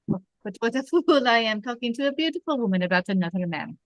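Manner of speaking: put-on lightly French-accented police captain. Captain tells the lady about Rick, French accent. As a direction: play Renault with a French accent. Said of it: "I think you do the accent".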